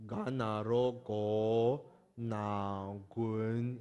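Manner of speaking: very slow, syllable by syllable; slow spelling out. Shown as a demonstration. Teacher spelling for students, drawn out slow. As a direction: spell it out for the class slowly. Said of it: "我们这样拼出来的话".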